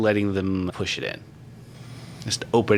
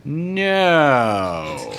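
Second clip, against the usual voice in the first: long drawn-out, sliding no; hammed-up dubious denial; exaggerated evasive denial; 5 semitones higher, 4 dB louder than usual. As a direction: stretch the no out, unconvincingly. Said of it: "what was his answer again".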